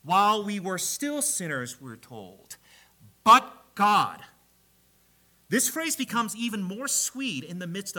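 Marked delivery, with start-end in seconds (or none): 3.2-4.2 "But God" rung out loud and falling; emphatic proclamation. Real, not acted. Preacher booming the refrain to the congregation. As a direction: hammer the two words home. Preacher repeats key phrase.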